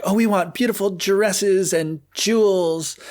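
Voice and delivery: high, slow spoiled sister voice; mock bratty stepsisters.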